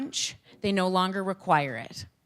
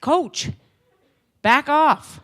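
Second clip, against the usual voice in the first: put-on annoyed kid; 8 semitones higher, 9 dB louder, much slower than usual. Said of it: "he's like".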